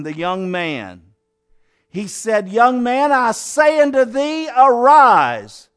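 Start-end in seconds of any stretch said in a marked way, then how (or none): 2.5-5.7 commanding call. Acted. Jesus commands dead youth.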